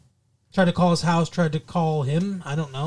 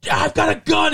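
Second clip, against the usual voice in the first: retold screaming; 10 semitones higher than usual. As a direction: yell it like a startled friend. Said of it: "he's, like, screaming".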